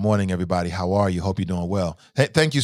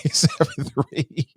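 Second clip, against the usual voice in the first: laughing; 7 semitones higher, slower than usual. Real, not acted. Host laughing while reading number.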